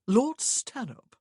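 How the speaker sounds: slow, loud, cheerful announcement; lightly hearty self-introduction. A narrator's reading. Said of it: "the gentleman said cheerfully".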